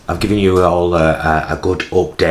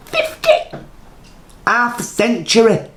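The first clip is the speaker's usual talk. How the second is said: high, squealing disbelief; mock shocked squeal. Real, not acted. To her pals, shrieking in mock horror at turning fifty.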